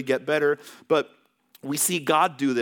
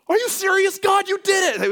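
high, loud mock astonishment; mock amazed shout; 8 dB louder than usual. Mock dad shouting at God, high amazed. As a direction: ham up an overexcited cry to God. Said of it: "He didn't go like".

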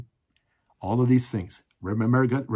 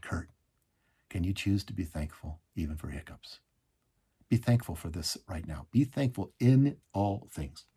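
low, soft voice of the Spirit; quiet, tender retold counsel; gentle hushed prompting; 5 semitones lower, 5 dB quieter than usual. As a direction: speak softly and unhurried, as inner guidance. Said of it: "what the Holy Spirit whispered to my heart".

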